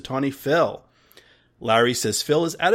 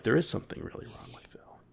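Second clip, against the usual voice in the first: hushed, fast spooky whisper; ominous whisper; 11 dB quieter, faster than usual. Acted. Host whispers the movie line back as a spooky aside. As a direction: breathe it like a dark secret.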